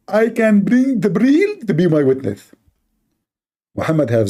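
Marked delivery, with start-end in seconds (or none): 0.1-2.5 mock apologist; lofty mock defender; high, slow pompous parody. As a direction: proudly overblown squeak.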